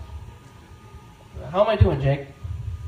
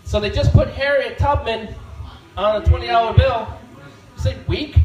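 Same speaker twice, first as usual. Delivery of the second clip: ordinary delivery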